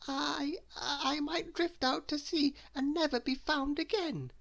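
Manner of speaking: high, quavering frightened squeak; trembling fear. A narrator's reading. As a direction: tremble through every word. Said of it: "trembling at the thought".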